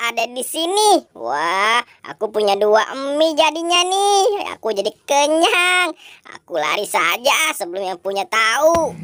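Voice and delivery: put-on low, sly boy voice; a greedy kid sneaking off with found food; lightly voiced mischievous boy; sneaky boy character.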